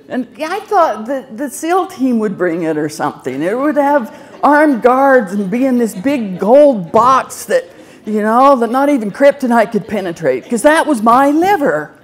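hamming up the grand expectations; excited laughing rant. Real, not acted.

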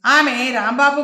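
usual speaking voice

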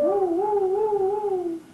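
high loud woo-woo siren; siren noise. Pretend. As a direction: wail like a tow truck siren. Siren imitation for toddler.

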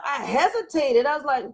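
normal talking voice

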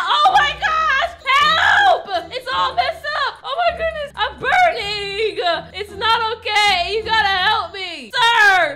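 high loud panic with laughing cries; panicked yelling. Acted. Burning in the restaurant, yelling for help in panic. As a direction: scream for help while burning.

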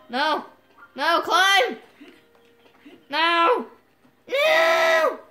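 loud, high cries building to a scream; panicked yelling. Real, not acted. Player failing to climb, panicked shouting.

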